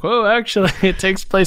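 mock surprise. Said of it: "It's just like".

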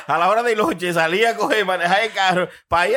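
high, laughing burst into words. Real, not acted. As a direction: laugh while telling it. Laughing while telling his lunch plan.

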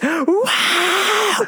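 long, drawn-out high wow; slow high breathless wow; awed wow. Real, not acted. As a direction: stretch the wow up in wonder. Awe at the ending.

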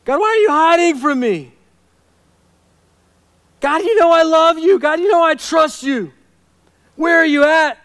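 loud, high-pitched anguished plea; pained cry. Acted. Suffering man demanding where God is hiding, pained cry. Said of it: "Can you hear the pain in this man's voice".

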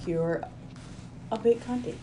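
put-on half-hearted British accent. Trying out the teased phrase, weak British accent. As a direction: have a tentative go at sounding British. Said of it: "No, more British".

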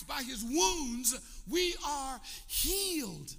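high, loud, very slow climactic proclamation; full-force preaching. Acted. Preacher to congregation, slow climactic proclamation of healing. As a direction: stretch each phrase out to the climax.

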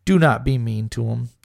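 usual speaking voice

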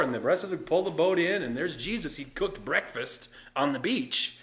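usual speaking voice

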